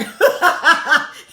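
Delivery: big burst of laughter, high; real loud laugh. Real, not acted. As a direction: crack up loudly. Laughing at lieutenant's remark.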